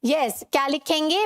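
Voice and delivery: ordinary talk, nothing marked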